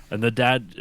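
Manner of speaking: ordinary talk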